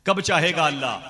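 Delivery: loud, high indignant challenge; acted outburst of an upset wife; angry wife. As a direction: throw the question back at him angrily. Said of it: "मेरी बीवी नाराज हो गई".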